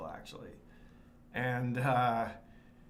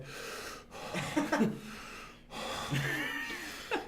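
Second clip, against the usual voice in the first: re-enacted breathless panicky gasping; mock panic attack; 13 semitones higher, 3 dB quieter than usual. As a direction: double over and wheeze as if panicking. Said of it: "Panic attack?".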